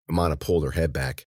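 plain reading voice

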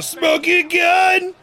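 slow, high announcer yell; drawn-out hype shout. Pretend. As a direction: belt it out like a ring announcer. Hyping a wrestling moment.

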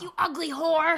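put-on loud high old-woman shriek; cranky old lady yell. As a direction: yell it like a grumpy resident. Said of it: "she'd be like".

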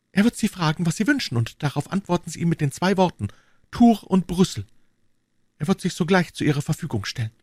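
put-on high young lady giving instructions; brief your helper in a high female voice; high-pitched, purposeful lady; Madame Bonacieux. A narrator's reading.

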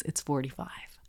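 whispered in disbelief; scandalized whisper. Real, not acted. Host reveals the school's tuition to co-host, whispering. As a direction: lean in and whisper the outrageous number.

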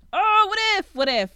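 high, loud gasp of realization; excited realization. Real, not acted. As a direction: it just hit you, blurt it out. Gasping at a new theory.

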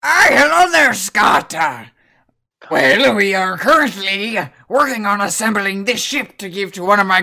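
lightly voiced cheery sea dog; hearty sailor greeting a visitor; jolly old shipwright.

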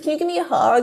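high-pitched wheedling imitation; mock a grown man begging like a baby; put-on needy little voice; babyish pleading. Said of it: "acting like babies and being like".

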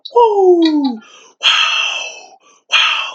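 high, drawn-out mock whoops; imitated screaming. Imitating Phillip Rivers in the lineman's ear, mock screaming. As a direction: whoop it out like a celebrating quarterback. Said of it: "he just keeps screaming".

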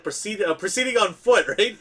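a light take on a police chief's radio call; Chief Wiggum. Acted. Fan reenacting the beer explosion scene, Chief Wiggum impression. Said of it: "you see Wiggum going".

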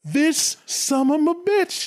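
high, slow, disgusted mutter; sulking god spitting out the insult; indignant God. Retold or imitated. God still griping about Lucifer.

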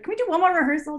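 hammed-up nervous begging; retold nervous plea. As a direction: squeak out a last-minute anxious request. Retold nervous plea for another rehearsal.

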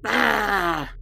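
high, drawn-out acted scream; hag's scream. Game master plays the hag being jumped by a cat, high scream.

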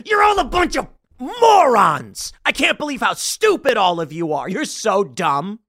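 high, loud, slow insulting yell; mock insulting yell. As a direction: sneer at the crowd, dragging it out. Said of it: "who comes out and goes".

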